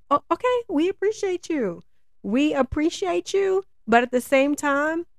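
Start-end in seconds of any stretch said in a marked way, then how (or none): 0.1-3.6 mock sweet thanks. Mocking passengers' fake thanks.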